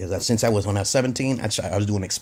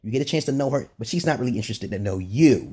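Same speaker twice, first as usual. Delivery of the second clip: ordinary talk, nothing marked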